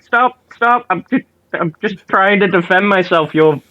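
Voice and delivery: high-pitched, indignant pleading; acted protest. Bard to the one grabbing him, high indignant protest. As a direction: protest your innocence, stretching the insult.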